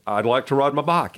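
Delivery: "ride" and "bike" with flat Texas vowels; Texas accent. Retold or imitated. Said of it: "deeply committed Texas accent".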